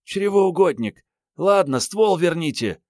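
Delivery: high-pitched scornful female character; scornful snort. A narrator's reading. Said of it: "Мариетта фыркнула".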